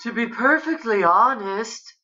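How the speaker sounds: Suzanne. A narrator's reading. Guest confesses at the table, in high earnest voice. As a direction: confide it with a flourish. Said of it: "Suzanne says".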